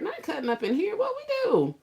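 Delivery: very high, loud feigned shock; mock innocence. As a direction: squeal in pretend disbelief at the accusation. Playing innocent to Miss Margaret.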